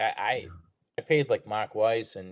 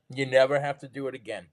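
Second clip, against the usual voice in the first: ordinary delivery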